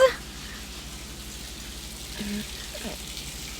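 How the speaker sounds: long defeated exhale; resigned sigh. Acted. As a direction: let out a long giving-up sigh. Gives in to the shower.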